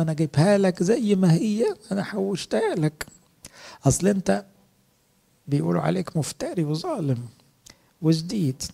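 a hint of a timid servant making excuses; servant's excuse. Lazy servant pleads to his master, mildly voiced.

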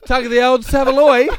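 mocking laugh. Cohost mocking his friend's saying with a loud laugh. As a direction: laugh through it, teasing.

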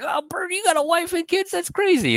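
high, hammed-up amazement; mock surprise. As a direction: gasp it out in fake disbelief. [name] surprised Bert has family.

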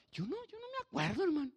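very high, squeaky denial; high-pitched, innocent-sounding quote; bewildered high reply. Retold or imitated. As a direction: protest in a tiny high voice. Retold bewildered reply after crying.

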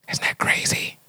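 hushed whisper of disbelief. Real, not acted. Preacher to the kids about the mother's scheme, hushed whisper. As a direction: lean in and whisper it like a secret.

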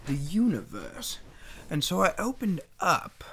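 slow, halting recital with swooping pitch; dramatic spoken poem. Pretend.

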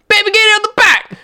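very high-pitched loud yell; acted screaming. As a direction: scream it as the fed-up customer. Acted customer yells repeated line.

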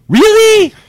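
a stunned, sky-high mocking "Really?"; incredulous really. Boss to the bragging driver, mocking shrill disbelief. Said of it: "Like that".

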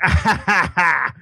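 high mock drunken guffaw; a hint of a drunk man laughing; imitating a big drunk laugh. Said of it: "like that big drunk laugh".

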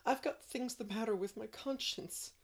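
sobbing. A narrator's reading. Anne confessing her bad day to Marilla, sobbing. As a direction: pour out the shame between sobs. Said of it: "sobbed Anne".

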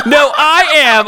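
slow, high, indignant retort; hammed-up argumentative Aladdin; arguing. A narrator's reading. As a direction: insist like a squabbling kid. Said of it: "Aladdin argued".

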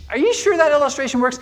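high, quick sceptical question; doubting listener. Acted. Listener challenging the pastor's illustration, high and doubtful. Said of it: "And you go".

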